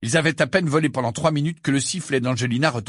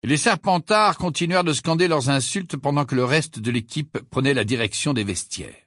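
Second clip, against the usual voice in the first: ordinary delivery, nothing marked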